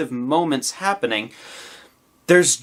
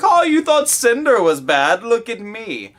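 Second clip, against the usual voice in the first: imagined villain; 9 semitones higher, 5 dB louder, slower than usual. Retold or imitated.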